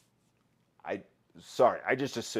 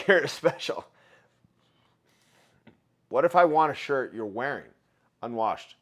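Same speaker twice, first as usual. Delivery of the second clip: ordinary delivery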